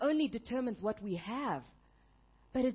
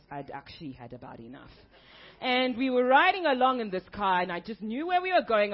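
ordinary delivery

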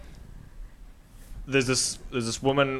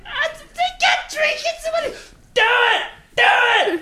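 panicked woman; 6 dB louder than usual. Retold or imitated. Clerk refusing the beaker, hammed-up laughing wail. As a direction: wail it in fake panic, cracking up. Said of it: "And she's like".

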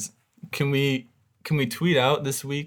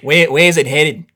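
put-on broad Aussie accent, chatty; Australian accent; 4 semitones higher, 9 dB louder, slower than usual. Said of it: "such a brilliant Australian accent".

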